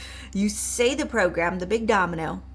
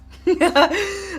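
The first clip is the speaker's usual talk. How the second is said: high excited laugh; 11 semitones higher, 8 dB louder than usual. Real, not acted.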